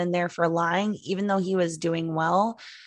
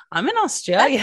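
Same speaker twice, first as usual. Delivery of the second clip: put-on light Australian accent, unhurried; slightly faked Australian accent, easygoing; 5 semitones higher, 7 dB louder than usual. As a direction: put on a casual Australian accent to fool a caller. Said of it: "he'll answer in a Australian accent".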